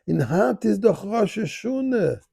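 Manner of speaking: high, slow Yiddish singsong; Yiddish accent. Retold or imitated. Guard explaining the holiday to the rabbi, high Yiddish accent. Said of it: "again in perfect Yiddish".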